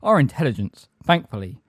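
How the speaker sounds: loud, measured indignation; offended retort. A narrator's reading. Magorian answering Umbridge's insult, offended and forceful. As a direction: put her firmly in her place.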